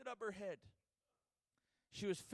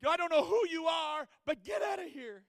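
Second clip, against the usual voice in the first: louder, higher telling off; nurse's order; 6 semitones higher, 11 dB louder than usual. Retold or imitated. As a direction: order them out.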